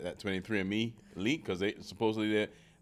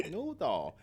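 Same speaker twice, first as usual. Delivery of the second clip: mock Chinese accent; 7 semitones higher than usual. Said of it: "you started me with the accents".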